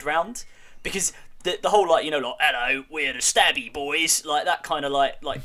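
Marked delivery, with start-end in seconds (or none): 2.4-4.2 put-on a light, slowed Cockney geezer accent, a bit louder; lightly voiced Cockney lads introducing themselves; Cockney orc. Mocking orc naming, in a half-hearted Cockney accent. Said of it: "Cockney, you know".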